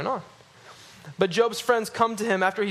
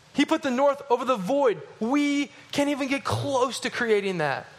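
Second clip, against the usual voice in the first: higher, rising sermon intensity; preaching at full force; 6 semitones higher than usual. Acted. Preacher marvelling at God hanging the earth, fervent. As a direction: drive the wonder home to the congregation.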